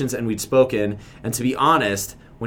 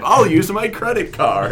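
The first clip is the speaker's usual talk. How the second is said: higher, laughing caricature of a clueless American tourist; 7 semitones higher than usual. Pretend. Host mocking Americans for his co-host, in a high goofy American voice. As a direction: ham up a dumb American voice, a bit higher. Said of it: "How do I do our American stereotype?".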